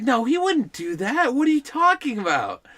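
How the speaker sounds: high, protesting mom voice; mock incredulity, pitched high; disbelieving mother. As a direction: imitate a mother refusing to believe it. Mother denies son did it.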